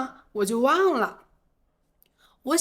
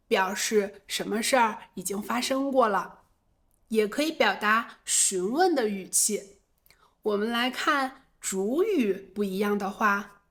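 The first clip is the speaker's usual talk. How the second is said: ordinary delivery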